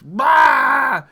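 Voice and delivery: loud, high burst of a shout; explosive bah. Real, not acted. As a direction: blast it out like an explosion. Explosive exclamation about Jupiter.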